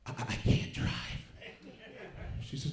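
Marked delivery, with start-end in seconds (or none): 0.1-0.9 stoned whisper. Retold or imitated. Stoned husband answers wife.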